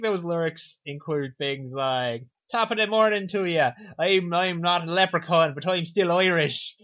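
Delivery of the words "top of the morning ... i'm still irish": mock Irish rap accent, louder and slowed at the end; hammed-up Irish accent. Guessing the Irish assassin's rap lyrics, hammed-up Irish accent. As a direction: lay on a stagey Irish brogue. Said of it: "that's Irish accent".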